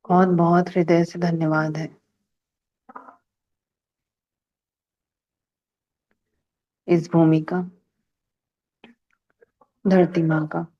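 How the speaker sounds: unhurried phrases with long silences between; guided meditation. Real, not acted. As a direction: lead a calm gratitude meditation, pausing after each phrase. Leads a gratitude meditation. (ठहर-ठहर कर शांत ध्यान निर्देश)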